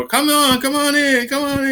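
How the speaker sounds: high, sing-song calling come on in; carnival barker. Retold or imitated. Women outside a peep show luring customers, high and sing-song. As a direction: beckon passersby like a fair barker. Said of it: "like, at a carnival".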